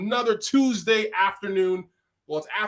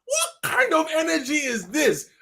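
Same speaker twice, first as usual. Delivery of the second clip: put-on Nigerian accent; 8 semitones higher than usual. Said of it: "my Nigerian accent comes out".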